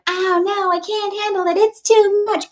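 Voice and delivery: high-pitched, put-on overwhelmed wail; mock whining. Imitating employees who resist a new procedure, mock whining. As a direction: imitate a helpless complainer, way up high.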